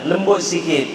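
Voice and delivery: ordinary talk, nothing marked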